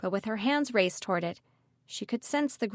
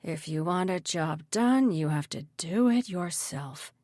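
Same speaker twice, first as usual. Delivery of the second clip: slow, grudging menace; exasperated mutter; 3 semitones lower, slower than usual. A narrator's reading. Mayor goes for his gun himself, slow mutter. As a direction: mutter it slowly to yourself. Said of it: "he muttered".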